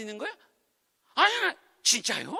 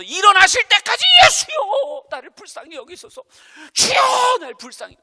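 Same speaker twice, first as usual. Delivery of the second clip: desperate prayer; 10 semitones higher, 11 dB louder than usual. Pretend. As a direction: plead to the Lord with all your strength. (간절하게 부르짖는 기도)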